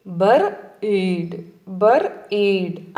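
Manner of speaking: బర్ఈడ్ slowly split as బర్, gap, ఈడ్; chunked pronunciation. Shown as a demonstration. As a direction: break బర్ఈడ్ into two drawn-out chunks. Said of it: "చంకు మెదడులో ఎలా పలకాలి?".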